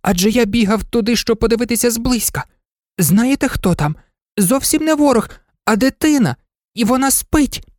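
high, earnest toy-dog voice; pleading little dog. A narrator's reading. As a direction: explain imploringly as a tiny dog. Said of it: "благав курдуплик". (благальний голосок собачки)

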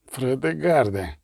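slow, weak repeated call; with effort. A narrator's reading. Dying commissar calling the knight, with effort. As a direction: labour the name out, badly wounded. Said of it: "із зусиллям відізвався Венг".

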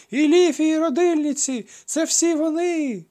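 very high, loud, slow screech; piercing shriek. A narrator's reading. Woman in the street shrieking at the crowd. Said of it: "пронизливий вереск".

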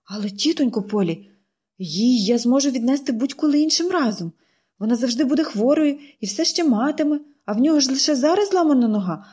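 put-on girl's voice rising as she pleads; girl pleading. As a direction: win the aunt over. Pollyanna pleads.

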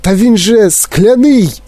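high, stammered protest; alarmed cry. A narrator's reading. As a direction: stretch out a horrified objection. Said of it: "закричав дідунь Джо".

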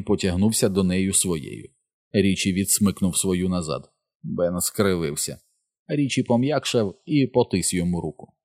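usual speaking voice

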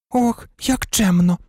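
high, slow, huffy retort; offended. A narrator's reading. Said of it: "образилася Джинні". (ображено, високо й протяжно)

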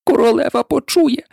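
anxious high-pitched warning; frightened whisper. A narrator's reading. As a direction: warn nervously under your breath. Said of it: "злякано зашепотів кролик".

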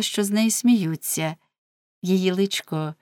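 ordinary delivery

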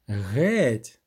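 understated menacing order; low, mildly angry dismissal; angry cry. A narrator's reading. Sorceress orders the witcher out, mildly menacing cry. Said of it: "крикнула вона, зловісно скривившись".

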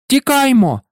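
sharp high cry to run; shouted. A narrator's reading. Harry shouting to friends to run, urgent cry. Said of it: "крикнув Гаррі".

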